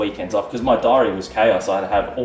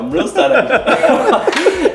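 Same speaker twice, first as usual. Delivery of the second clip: very high, loud squeal through laughter; slow, squeaky protest, cracking up; mock indignant; 7 dB louder, much slower than usual. Retells his indignant comeback, laughing.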